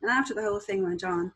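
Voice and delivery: ordinary talk, nothing marked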